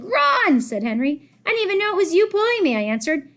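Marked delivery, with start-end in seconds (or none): 0.0-0.6 high, loud shout. Retold or imitated. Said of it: "I was like". 1.4-2.8 fast, high and loud; excited. Acted. Excited after the chase.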